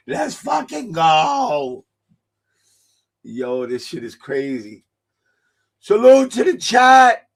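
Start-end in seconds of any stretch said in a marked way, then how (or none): 0.1-1.8 high, slow, drawn-out hype yell; rally the chat, stretch it out; hype shout. Real, not acted. 5.8-7.3 high loud shout-out; loud sign-off shout to viewers; shouted salute. Real, not acted.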